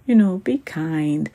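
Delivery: ordinary delivery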